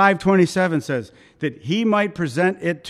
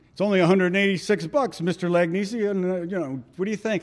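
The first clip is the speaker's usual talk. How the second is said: slightly higher, quick phone-salesman patter; salesman's pitch; 3 semitones higher, faster than usual. Retold or imitated. Salesman pitching lawn care to a customer, quick pitch. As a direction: rattle off a friendly sales pitch.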